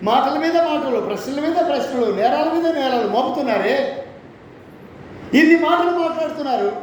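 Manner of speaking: fervent preacher; preaching at full force. Acted. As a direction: pound out the rebuke from the pulpit. Preaching God's rebuke.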